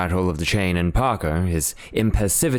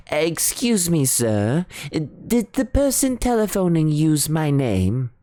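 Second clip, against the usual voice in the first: put-on higher, deferential old butler; 10 semitones higher than usual. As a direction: play the prim, startled butler. Butler Parker asking.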